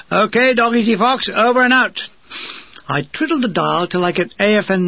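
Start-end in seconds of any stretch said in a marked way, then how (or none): 0.1-2.0 high, louder signaller's voice; radio sign-off. A narrator's reading. Signaller ending the test call, radio sign-off. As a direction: sign off briskly over the radio.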